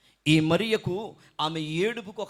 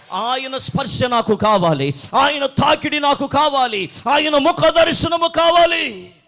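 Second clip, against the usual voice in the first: loud cry climbing higher with each phrase; impassioned plea; 9 semitones higher, 16 dB louder than usual. Acted. Preacher voices weeping Mary longing for Jesus, crying out louder and higher. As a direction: build to a high, drawn-out shout. Mary's plea acted at full force.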